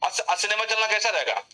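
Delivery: ordinary talk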